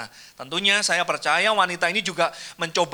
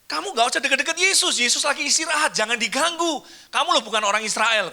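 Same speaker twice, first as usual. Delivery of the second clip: dismissive disciples; 8 semitones higher than usual. Retold or imitated. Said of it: "murid-murid Yesus ngomong ini".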